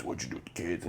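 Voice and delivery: whispered, pressing repeat of the question; hushed repeat. Retold or imitated. Father presses the teacher at the gas station, whispering. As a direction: drop to a tense whisper. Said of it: "kinda confronts her and is like".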